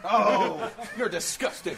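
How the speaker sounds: loud laughing mock disgust. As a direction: scold the co-commentator with a laugh. Commentator scolds the other.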